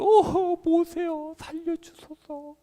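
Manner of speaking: sick Miriam crying to her brother, pleading. Acted. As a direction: plead for your life in a thin high voice. Miriam begs Moses for help.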